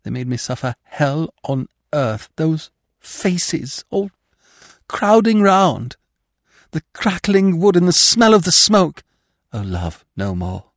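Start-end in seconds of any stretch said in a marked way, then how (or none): 2.3-9.0 high, loud, anguished cry as the flames return; anguished memory. Acted. Ghost recalling the fire to her lover, high anguished cry. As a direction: relive the burning in horror.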